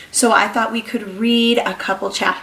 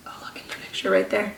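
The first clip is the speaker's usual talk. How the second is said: whisper; 9 dB quieter, much faster than usual. Real, not acted. Pointing out a clue.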